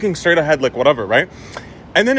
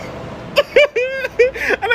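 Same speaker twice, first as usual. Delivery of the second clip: long, high mocking giggle; high-pitched gloating laughter; taunting laugh. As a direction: laugh right at her, high and drawn out. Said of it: "and I just go".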